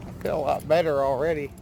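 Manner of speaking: talking through numb lips. Real, not acted. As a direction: speak with a numbed mouth after dental work. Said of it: "my lips are still super numb".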